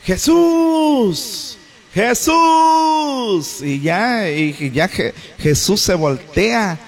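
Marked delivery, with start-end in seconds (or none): 0.0-3.6 high, drawn-out shout; long pleading call across a distance; calling out from afar. Acted. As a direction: cry out to someone far away, stretching the vowels. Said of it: "y le gritaron diciendo".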